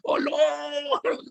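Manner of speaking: high, drawn-out mock wail; imitated prayer cry. Preacher imitating people who cry out five times daily, mock high cry. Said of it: "Lima kali teriak".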